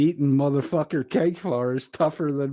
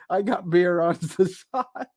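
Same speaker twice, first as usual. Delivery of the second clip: laughing misread; 7 semitones higher than usual. Real, not acted. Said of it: "I'm laughing so hard I can't fucking read right".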